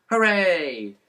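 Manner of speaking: put-on high, loud child's cheer; little boy cheering his dad's news; excited yell. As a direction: whoop it twice like a thrilled little boy. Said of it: "yelled little Harry".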